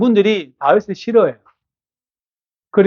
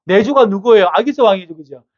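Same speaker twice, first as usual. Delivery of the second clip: normal talking voice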